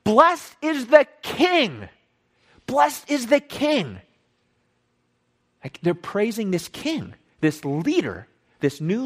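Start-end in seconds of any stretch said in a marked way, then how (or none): 0.0-4.0 slow, high acclaiming shout; drawn-out, raised-pitch shout of praise; crowd shouting. Acted. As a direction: proclaim it like a cheering crowd. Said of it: "the people are shouting".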